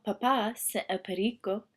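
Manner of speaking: plain reading voice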